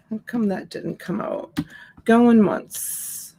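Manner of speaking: ordinary talk, nothing marked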